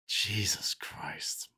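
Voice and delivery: slow, breathed whisper of disbelief; whispered dismay. Real, not acted. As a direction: whisper it to yourself, stunned. Dismayed aside after the debate.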